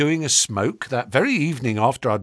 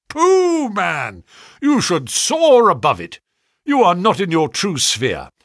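high, loud dismissive pooh, then emphatic urging; scoffing encouragement; 6 semitones higher, 6 dB louder than usual. A narrator's reading. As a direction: wave away his modesty grandly. Visitor scoffs at clerk's modesty.

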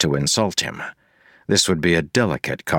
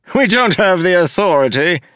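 "We" thrown back high and loud, mocking; sneering mayor; 13 semitones higher, 6 dB louder than usual. Mayor Entrone mocking Wax's words back at him, high and loud. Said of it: "Entrone said".

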